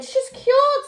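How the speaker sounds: doctor announces Granny is cured; happy doctor. Acted. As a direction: announce the good news gladly. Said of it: "said the doctor".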